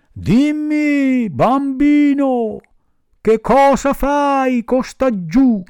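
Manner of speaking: high, slow bird-like voice calling from above; high drawn-out pigeon calling down. Acted. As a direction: call down gently as a big bird.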